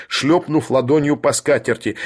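usual speaking voice

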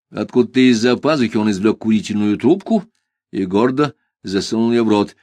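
plain reading voice

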